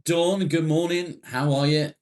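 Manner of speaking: high, loud morning hail; calling out greeting. Real, not acted. Hailing a viewer joining the stream.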